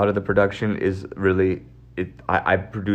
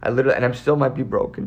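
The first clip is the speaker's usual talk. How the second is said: ordinary talk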